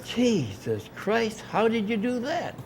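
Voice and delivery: drawn-out, high-pitched disbelief; retold astonishment. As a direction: imitate someone utterly flabbergasted. Retold astonishment at missing book.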